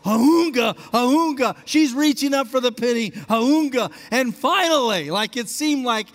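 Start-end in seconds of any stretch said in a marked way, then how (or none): 0.0-1.6 loud rhythmic heaving grunts; effort grunts. Retold or imitated. Mother reaching for the penny. 3.1-3.9 loud rhythmic heaving grunts; effort grunts. Retold or imitated. Mother reaching for the penny.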